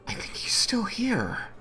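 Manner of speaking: high hushed whisper; high breathy critter whisper. Acted. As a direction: lean in and whisper the suspicion. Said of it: "she turns around and kinda comes up".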